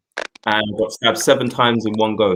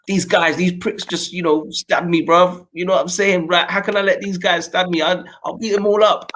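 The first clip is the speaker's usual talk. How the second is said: higher, heated street talk, replaying the rage; fired up, cursing the guys who stabbed him; mock furious vow to fight back; retold angry outburst; 8 semitones higher than usual. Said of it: "I was like".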